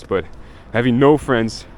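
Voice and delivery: usual speaking voice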